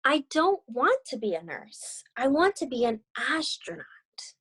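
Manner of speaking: gentle read-aloud storytelling voice; story narration. A narrator's reading. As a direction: read it like a picture book to young students. Teacher reads picture book aloud.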